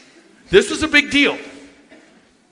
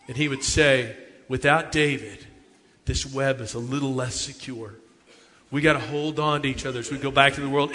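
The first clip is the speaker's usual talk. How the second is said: much softer, lower solemn youth minister; hushed solemn minister; 8 semitones lower, 9 dB quieter than usual. Retold or imitated. Youth minister teaching the kids a lesson, hushed. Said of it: "the youth minister would say".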